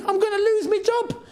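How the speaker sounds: hammed-up despairing cry; mock wail. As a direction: wail it like a doomed manager, tongue in cheek. Jokey panic over the substitutions.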